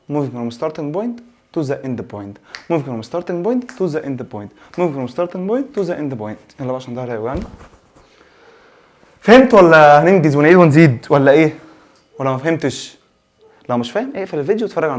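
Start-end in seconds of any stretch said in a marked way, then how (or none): 0.1-6.6 drawn-out, softer words for copying down; slow dictation. Shown as a demonstration. Teacher dictating the definition, slowly and softly. 9.2-11.6 louder challenge. Real, not acted. Teacher challenging his students.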